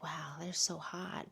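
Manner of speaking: softly whispered gasp of admiration; whispered awe. Retold or imitated. Ember seeing four hot stepbrothers walk in, whispered awe. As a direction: whisper it, stunned by them.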